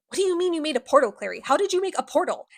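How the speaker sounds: high, hurried disbelieving questions; mock incredulity. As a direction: fire off baffled questions, acting it out. Acted quarrel, Izzy questions Clary.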